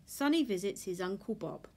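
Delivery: plain reading voice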